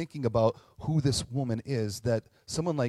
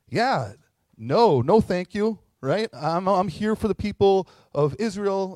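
loud, slow, dismissive refusal; yelling back; 6 semitones higher, 7 dB louder, slower than usual. Retold or imitated. Jesus yells back at the pleading mother, loud brush-off. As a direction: shout her off, higher and louder. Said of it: "Jesus essentially yells back at her".

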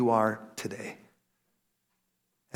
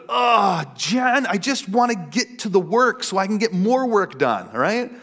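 higher-pitched, exasperated mock complaint; impatient protest; 6 semitones higher than usual. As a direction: act out whining impatience to get on with work. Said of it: "I would just be like".

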